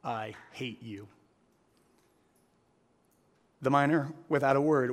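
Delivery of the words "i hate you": low, soft, slow words through clenched teeth. A narrator's reading. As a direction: spit it out quietly through locked teeth. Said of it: "said through clenched teeth".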